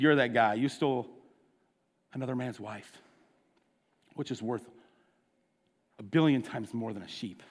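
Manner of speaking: low and soft, a grave rebuke. Retold or imitated. Prophet Nathan quietly confronting King David, low and soft. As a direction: lean in and drop it low, quietly damning.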